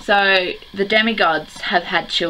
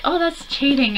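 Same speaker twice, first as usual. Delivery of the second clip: lightly mimicked higher-pitched objection; a hint of a mocking sister voice; imitating sister; 8 semitones higher than usual. Vlogger quoting sister on pop-up books, lightly imitated in higher pitch. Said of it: "my sister was like".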